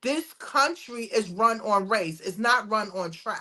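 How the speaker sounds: usual speaking voice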